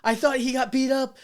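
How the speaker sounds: high, loud, desperate apology; high pleading apology. Retold or imitated. Apologizing to bar regulars, high and pleading. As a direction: plead your innocence loudly. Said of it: "I was like".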